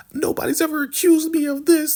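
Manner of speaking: very high put-on falsetto protest; mock melodramatic denial; hammed-up wronged innocence. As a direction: overplay being falsely accused, squeaky high. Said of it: "She's really playing it hard".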